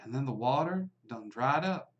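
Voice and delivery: hushed storytelling bartender, slowing for effect; bartender telling a legend. Acted. Bartender telling the stranger the town's dark legend, hushed. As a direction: lean in and spin the old town tale.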